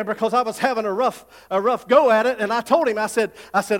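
ordinary talk, nothing marked

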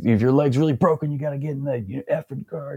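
retelling the chief's yell with only a lifted pitch; mock yell. Chief barking at the recruit to get in the car, as a mild mock yell. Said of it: "he's screaming at me".

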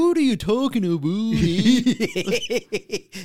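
high, loud caricature Canadian; Canadian accent. Shown as a demonstration. Friend showing off a mock Canadian accent. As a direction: belt it out high with exaggerated Canadian vowels. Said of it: "you say the Canadian accent".

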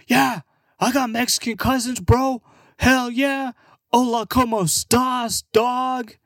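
high-pitched hammed-up party bro; drunk American bro. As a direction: holler like a drunk wannabe cousin, way up high. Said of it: "and be belligerent".

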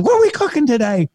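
excited Southern rep. Retold or imitated. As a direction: play the overjoyed phone guy from the South. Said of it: "because of the guy's accent".

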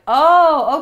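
high, loud, drawn-out "oh"; mock realization. Boyfriend finding girl talk on Instagram, mock realization. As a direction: imitate a boyfriend catching you out. Voices boyfriend discovering her messages.

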